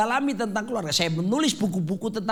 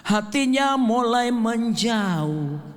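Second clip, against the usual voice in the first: dramatic pronouncement; much slower than usual. Acted. Dramatic pronouncement, slow and drawn.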